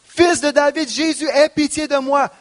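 high, pleading cry. Acted. Beggar in his need calls Jesus, pleading cry. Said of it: "il a crié".

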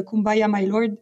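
ordinary delivery

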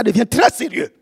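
joking touch of African accent. Shown as a demonstration. Preacher joking to the congregation, African accent. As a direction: let the African accent slip out. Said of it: "l'accent africain revient".

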